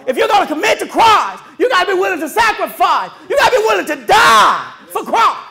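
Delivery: high, loud preacher's call on sacrifice; full-force preaching. Acted. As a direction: shout it out to the congregation like a preacher in full flight.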